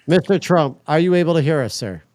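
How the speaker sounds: loud call to a silent guest. Real, not acted. Calling out to silent AI.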